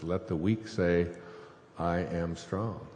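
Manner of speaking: measured words, slowed right down; slow, deliberate quote. Acted. Quoting Joel to the audience, slow and deliberate. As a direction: give each word of the verse its own weight.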